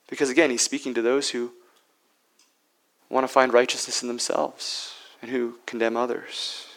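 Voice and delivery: normal talking voice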